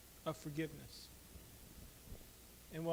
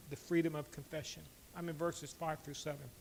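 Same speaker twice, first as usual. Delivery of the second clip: ordinary talk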